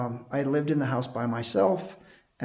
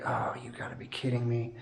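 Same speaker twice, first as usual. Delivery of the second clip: hushed whisper of disbelief; whispered dismay; 6 semitones lower, 7 dB quieter than usual. Retold or imitated. Recalling losing the dog tag, a whispered disbelief. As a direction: breathe it out, stunned.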